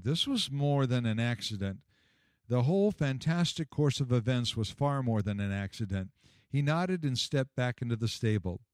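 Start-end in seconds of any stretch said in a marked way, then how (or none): none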